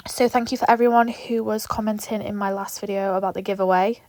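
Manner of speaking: ordinary talk, nothing marked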